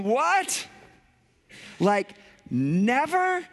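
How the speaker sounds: high, slow disbelief, drawing out never; incredulous. Retold or imitated. Pastor voicing a doubting congregation, incredulous. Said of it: "anybody else going".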